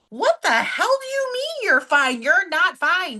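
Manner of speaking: sharp high inner voice calling her own bluff; scolding herself. Retold or imitated. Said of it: "And immediately I was like".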